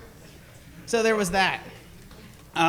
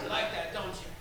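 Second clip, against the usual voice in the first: soft taunt; 11 dB quieter than usual. Pretend.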